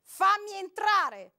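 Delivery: man screaming his last demand; final shout. A narrator's reading. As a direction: drag the order out as a yell.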